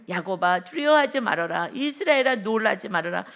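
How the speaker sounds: loud, firm proclamation; emphatic declaration; scripture repeated. Acted. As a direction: call it out to the people as God's promise. Repeats God's words to people.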